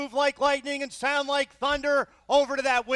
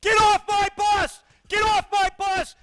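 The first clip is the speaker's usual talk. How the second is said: drill sergeant shout; 5 semitones higher than usual. Acted.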